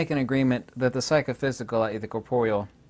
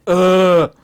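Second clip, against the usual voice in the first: caveman grunt; 6 semitones higher, 10 dB louder than usual. Retold or imitated. Caveman beating the radio.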